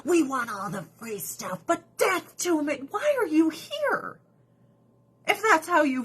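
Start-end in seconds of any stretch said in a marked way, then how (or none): 0.0-1.6 mock whisper. Mock protester demand. 2.9-4.2 much higher, slow disbelieving demand; outraged. Real, not acted. Asking protesters, high slow outraged question.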